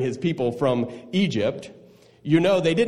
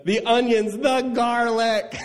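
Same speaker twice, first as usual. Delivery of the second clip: high, drawn-out comic lament; mock wailing; 8 semitones higher than usual. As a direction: wail the list, close to laughing. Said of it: "started wailing and said".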